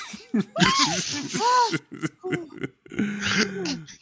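high, shouted burst of laughter; loud whooping laugh. Real, not acted. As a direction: howl with laughter. Big laugh at astronaut joke.

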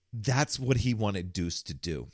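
ordinary talk

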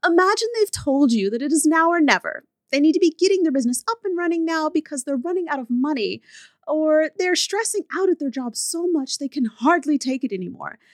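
high-pitched put-on businesswoman; woman professional's voice. Woman professional quoting a hesitant client, in a high put-on voice. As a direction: read it as a woman, pitched well up. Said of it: "a woman professional's blog. So she goes".

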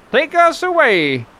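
ordinary talk, nothing marked

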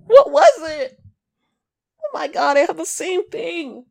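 high, loud exaggerated sympathy; overdone fake concern; mock caring customer service. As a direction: fake care with a high gushing voice. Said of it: "I would've been like".

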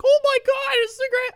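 very high, loud squeal of delight; mock gushing excitement. Gift receiver gushing over a present, mock squealing. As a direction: imitate someone overjoyed at a present. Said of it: "even people get gifts".

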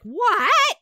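high, drawn-out disbelieving "what?"; stunned, exaggerated what; incredulous what. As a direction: imitate a baffled viewer reacting, pitch shooting up. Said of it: "be like".

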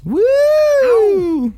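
very high, loud whoop; cheering. Real, not acted. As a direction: whoop in celebration. Cheering a level-up.

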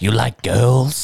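sleazy strip club guy. Pretend.

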